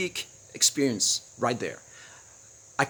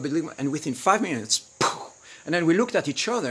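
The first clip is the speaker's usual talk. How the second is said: ordinary delivery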